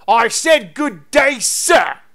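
loud, huffy Cockney professor; indignant Cockney, punched out loud; Cockney accent. Acted. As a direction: dismiss them with a Cockney shout. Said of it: "Now the Cockney accent works".

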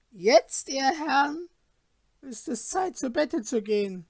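a stern hostess sending guests to bed; innkeeper's wife. Acted. Innkeeper's wife voiced in story.

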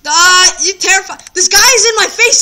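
loud high startled cry; panicked yell. Real, not acted. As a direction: yelp as something jumps at you. Mob suddenly in his face.

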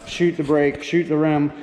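ordinary delivery

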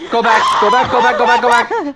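yelling go back in panic; frantic repeated shout; panicked shouting. Real, not acted. Fleeing a monster in game.

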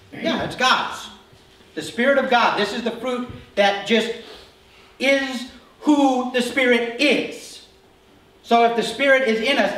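forceful sermon; emphatic preaching. Real, not acted. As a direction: drive the point home to the men. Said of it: "preaching at full force".

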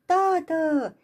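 high, loud call for her father. Acted. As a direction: burst in calling Dad. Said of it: "strigă ea".

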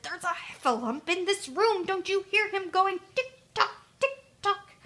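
high, loud, urgent squeal; frantic little Piglet; panicked alarm. Acted. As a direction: rouse a sleeping friend in fright. Piglet pushes Pooh awake, alarmed.

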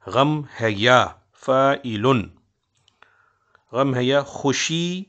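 slow, syllable by syllable; scanning the meter. Shown as a demonstration. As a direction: spell out each beat of the meter slowly. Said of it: "लेकिन बोलते किस तरह?". (धीमे-धीमे वज़न पर पढ़ना)

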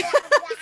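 a short, high, sudden burst of laughter; embarrassed laugh. Real, not acted. Host laughing as her son cuts into the talk. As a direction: laugh it off, caught out by the interruption.

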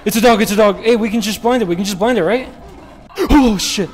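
loud alarmed rush; panicked. Real, not acted. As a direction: panic as a monster charges. Dog monster appears.

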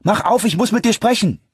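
urgent high, loud shout; calling out. A narrator's reading. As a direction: shout to be let in. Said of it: "rief ich". (lautes, drängendes Rufen)

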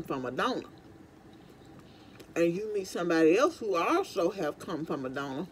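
eating between words; talking while chewing. Real, not acted. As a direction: mouth partly full of food. Seen 3: Hand brings food to mouth at 0.2, then cheeks and jaw show chewing.

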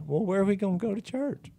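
a high, fretful "where do we go now"; mock worried churchgoer. Mocking what most people would say.

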